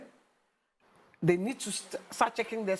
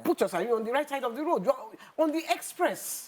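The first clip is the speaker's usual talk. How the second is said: angry; 7 semitones higher than usual. Retold or imitated. Scolding police car on road.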